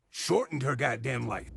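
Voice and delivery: ordinary delivery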